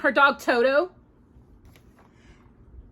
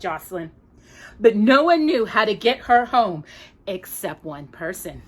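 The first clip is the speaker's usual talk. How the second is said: ordinary delivery, nothing marked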